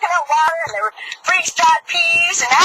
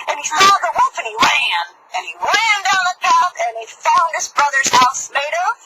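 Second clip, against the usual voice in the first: ordinary delivery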